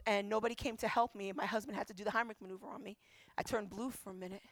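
low, soft, subdued voice; understated retold woe, voice sinking; dejected recounting. Injured woman telling pastor nobody came to help, subdued.

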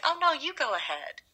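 lightly voiced polite stranger; polite Southern driver. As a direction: wave the other driver on. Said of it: "it's like".

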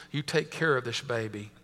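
low, soft-spoken, understated command; firm, commanding. A narrator's reading. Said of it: "He said in a firm, commanding voice".

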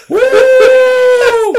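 very high, very loud mock whoop; sarcastic whoop. Coworker mocking Linda, imitated sarcastic whoop. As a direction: let a jeering whoop rip. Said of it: "basically what it is is sarcastic".

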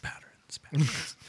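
breathy whisper, slowed down; whispered echo. Acted. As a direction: whisper the echo like a spooky repeat. Echoing dark patterns in whisper.

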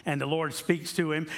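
ordinary talk, nothing marked

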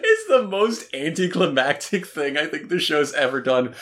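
ordinary delivery

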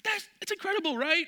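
very high amazed squeal; astonished squeal. Retold or imitated. Friend squealing at the knee shot, very high. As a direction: squeal in disbelief.

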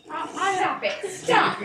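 loud, rising protest. Acted. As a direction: shout stop it, flustered. Character protests teasing.

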